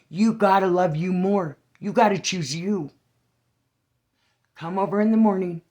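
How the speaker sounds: loud, urgent encouragement; forceful pep talk. Retold or imitated. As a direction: push her hard to choose herself. Retold urging the girl.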